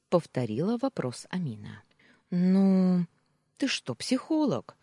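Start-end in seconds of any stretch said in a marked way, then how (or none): 2.3-4.8 loud stalling, then higher deflecting question; hiding confusion. A narrator's reading. Wife dodges the maid's question, masking confusion. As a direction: cover the fluster with a teasing question. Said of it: "пытаясь скрыть растерянность".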